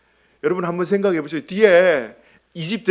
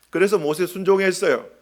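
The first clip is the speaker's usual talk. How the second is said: ordinary talk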